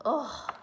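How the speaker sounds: drawn-out "ugh" of dismay; class groan. A narrator's reading. Class hearing they have homework, groaning. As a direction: groan at bad news. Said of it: "Everyone groaned".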